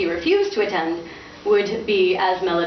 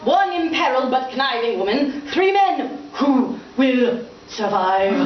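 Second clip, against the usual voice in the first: grand theatrical announcer; 6 semitones higher than usual. Acted. Father proclaiming his play bill theatrically. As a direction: sell the play like a barker.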